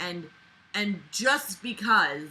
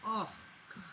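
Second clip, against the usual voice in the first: muttered insult; 6 semitones lower, 15 dB quieter, faster than usual. Real, not acted.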